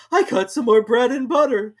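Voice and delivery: high, loud start; high, loud Hatter; Hatter telling. A narrator's reading. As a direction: carry on nervously. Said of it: "continued the Hatter".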